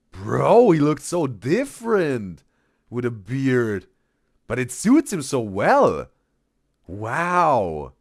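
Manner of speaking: loud, drawn-out amazement; real wowed reaction, punched loud; amazed. Real, not acted. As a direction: marvel at it with big swoops in pitch. Amazed by bearded photo.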